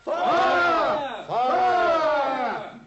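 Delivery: loud alarm cries; shouting fire. Acted. Watson joins the fake fire alarm, shouting loud. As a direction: yell fire down the corridor. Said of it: "join me in a shout of fire".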